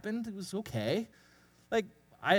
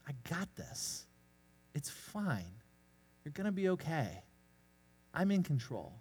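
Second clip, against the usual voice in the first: gentle voice of Jesus; 6 dB quieter than usual. Retold or imitated. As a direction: hush it down like a soothing promise. Jesus reassuring the anxious speaker.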